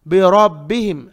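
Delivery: बिरब्बिहिम loud, with thick rounded ra; weight the ra in बिरब्बिहिम; heavy ra. Shown as a demonstration. Teacher corrects the mistake, heavy ra. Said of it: "रा को मोटा पढ़ेंगे".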